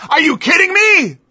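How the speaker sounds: high, loud, disbelieving cry; incredulous scoff. Real, not acted. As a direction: throw the question back in scorn. Scoffing at church restraint claim.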